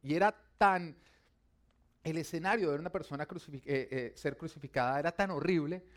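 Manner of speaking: loud, rising sermon delivery; impassioned preacher building in volume; forceful preaching. Real, not acted. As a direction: drive the point home to the congregation.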